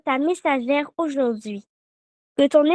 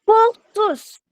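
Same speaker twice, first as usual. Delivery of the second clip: raised call to repeat; 5 semitones higher, 3 dB louder than usual. Shown as a demonstration. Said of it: "Répétez après moi".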